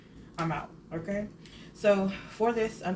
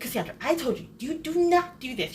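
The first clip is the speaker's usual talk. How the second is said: high, louder scolding, imitating the eyebrow lady; mock angry scolding; 8 semitones higher, 5 dB louder than usual. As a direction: scold her like a cross beautician, hammed up. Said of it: "she gets so mad".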